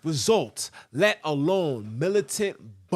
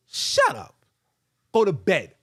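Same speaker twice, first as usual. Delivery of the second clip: sharp raised-voice telling-off; scolding outburst; 3 semitones higher, 4 dB louder than usual. Real, not acted. Sudden scolding outburst at imagined people.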